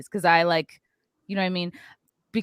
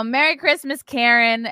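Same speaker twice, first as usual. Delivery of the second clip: higher, louder sing-song "Merry Christmas, Karen"; mock-cheerful parting shot; cheeky jab; 4 semitones higher, 5 dB louder than usual. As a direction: toss it out sweetly as a dig. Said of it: "And then I go".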